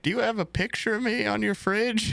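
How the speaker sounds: put-on high, sweet old-lady voice; grandma voice. Grandson imitates grandma asking about her painting, high and sweet. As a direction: mimic a frail grandma showing off. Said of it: "She's like".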